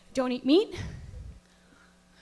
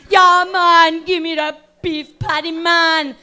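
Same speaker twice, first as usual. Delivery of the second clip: put-on loud opening Jamaican accent; 6 dB louder, slower than usual. Requested Jamaican accent impression, loud start.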